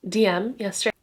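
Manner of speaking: ordinary talk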